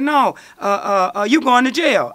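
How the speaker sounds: officer announcing arrest, mock loud; arresting cop. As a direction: hammer the arrest at him. Police arresting him.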